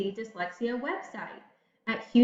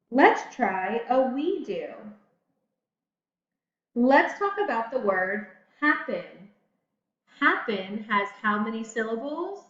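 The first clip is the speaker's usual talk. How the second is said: ordinary talk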